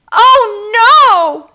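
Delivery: high, loud alarmed cry; Tanya crying out as she slips. Acted. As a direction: cry out as you wobble. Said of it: "she cried".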